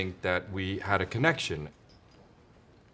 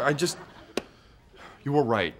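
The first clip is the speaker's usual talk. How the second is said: usual speaking voice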